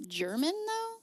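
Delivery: high questioning rise on though; doubtful German?; incredulous repeat; puzzled question. Real, not acted.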